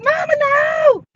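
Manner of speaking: high, shouted plea; kids begging. Retold or imitated. Kids shouting at their mother mid-fight: high plea. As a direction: cry out for mama to stop.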